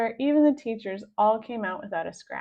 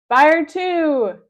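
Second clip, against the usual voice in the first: loud, higher firing order; command shout; 7 semitones higher, 13 dB louder than usual. A narrator's reading. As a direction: bark the order to fire. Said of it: "cried Captain Underpants".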